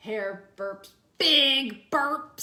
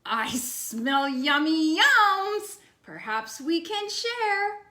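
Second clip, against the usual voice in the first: slow, louder badger savoring a smell; 3 semitones higher, 6 dB louder, slower than usual. Badger smells food and offers to share, slow hungry voice. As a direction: sniff out the treat and share it, slow and full. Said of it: "sniff snuffs at the air".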